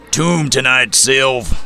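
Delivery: gruff bartender, grumbled loud and high; gravelly voice. A narrator's reading. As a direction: grumble it like a rough old barkeep. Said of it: "His gravelly voice sounded like a building implosion".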